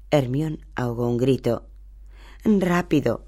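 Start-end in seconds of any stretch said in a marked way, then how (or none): 2.4-3.2 a frightened cry, pitch jumping higher; whimpering. A narrator's reading. Hermione fleeing the werewolf, frightened whimpering cry. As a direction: gasp it in panic as the werewolf nears. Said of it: "gimió".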